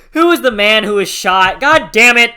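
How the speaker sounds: loud, high furious yell; angry shouting. Real, not acted. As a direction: shout it out in real frustration. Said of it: "I was so pissed".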